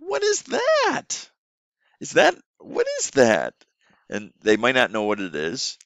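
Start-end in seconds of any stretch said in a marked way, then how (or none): 0.0-3.5 put-on high, slow, sing-song questions to a small child; teacher voice for kids. Shown as a demonstration. Online teacher asking a young student, sing-song and high. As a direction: ask it like a playful teacher to a toddler. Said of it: "draw a picture around that".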